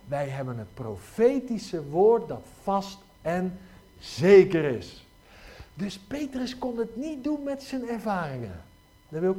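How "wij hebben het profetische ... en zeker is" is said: each word weighed slowly, zeker is ringing out loud; solemn proclamation, slow and loud at the end; slow, emphatic quote. Acted. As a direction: preach it slowly, landing hard on "zeker is". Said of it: "wat zegt Petrus".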